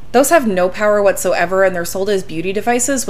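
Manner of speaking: normal talking voice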